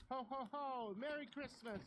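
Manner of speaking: put-on high excited child's shout; child yelling. Little girl shouting Christmas greetings into the night. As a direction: yell it to the night sky. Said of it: "I screamed at the top".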